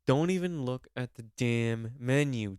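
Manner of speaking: usual speaking voice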